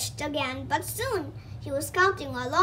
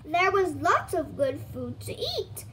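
ordinary delivery

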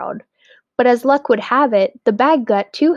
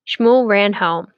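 usual speaking voice

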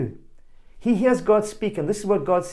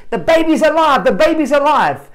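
high, loud joyful cry of the nurses; excited screaming; 7 semitones higher, 7 dB louder than usual. Retold or imitated. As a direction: shout the good news up and down the aisles. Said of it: "they are screaming".

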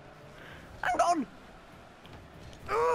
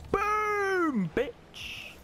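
gloating boom. Real, not acted. Scoring, taunting.

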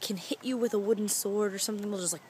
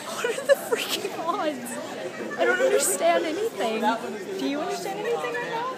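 disbelief; 8 semitones higher than usual. Retold or imitated. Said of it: "we were just like".

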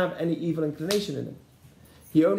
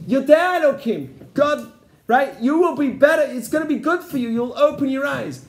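higher, louder eager tempting; the snake before Adam ate, hammed-up enticing; tempter's promise; 6 semitones higher, 4 dB louder than usual. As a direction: coax with big promises. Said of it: "what did he say".

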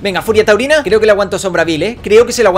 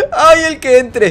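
excited taunt; 7 semitones higher, 4 dB louder, slower than usual. Real, not acted. Repeats taunt.

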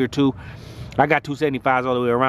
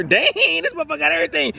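high amazed outburst; 13 semitones higher than usual. Real, not acted. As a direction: gasp it out in disbelief.

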